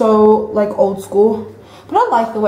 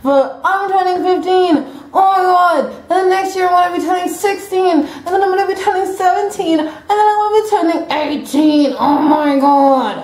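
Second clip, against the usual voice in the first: excited; 8 semitones higher than usual. Real, not acted. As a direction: gush in a high, stretched voice. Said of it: "I'm so excited".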